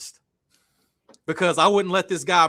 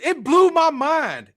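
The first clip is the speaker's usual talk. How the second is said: ordinary talk, nothing marked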